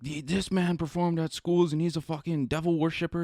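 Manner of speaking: high-pitched imitation of scandalized critics; mock-horrified accusation; mock outrage. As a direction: put on a pearl-clutcher's high voice. Mimicking outraged critics of the rapper.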